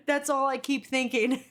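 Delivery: usual speaking voice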